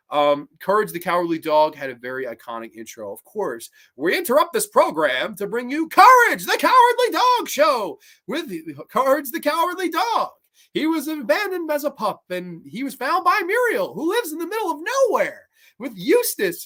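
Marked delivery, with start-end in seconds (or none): 4.0-10.3 high, loud dramatic TV announcer. Retold or imitated. Fan imitating the cartoon's intro announcer, high and loud. 10.7-16.6 higher storybook intro voice; lightly voiced narrator. Retelling the show's intro in a lightly raised narrator voice.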